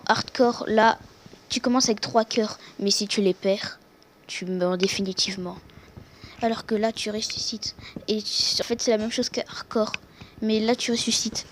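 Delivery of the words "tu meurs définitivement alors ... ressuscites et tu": whispering. Real, not acted. Aside about respawning in hardcore.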